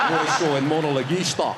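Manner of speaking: Spanish with a broad Glaswegian twist; Glaswegian accent. Shown as a demonstration. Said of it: "Spanish with a Glaswegian twist".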